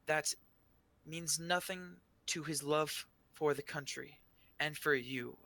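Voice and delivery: lightly voiced shy official at a podium; shy arcanist. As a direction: pause between phrases as a nervous public speaker. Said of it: "she's looking a little, little shy".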